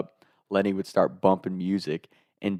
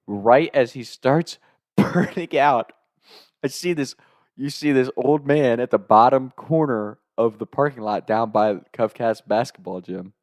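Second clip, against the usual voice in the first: usual speaking voice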